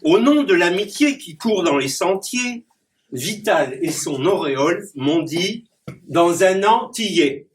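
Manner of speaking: declaimed rhyming verse with punchy rhyme endings; poem recitation. Acted. Clown reciting his rhyming poem to the audience. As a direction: perform the poem for the audience, landing each rhyme.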